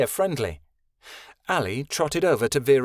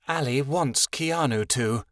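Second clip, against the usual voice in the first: ordinary delivery, nothing marked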